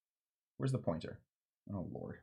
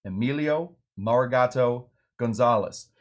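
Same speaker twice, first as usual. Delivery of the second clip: ordinary talk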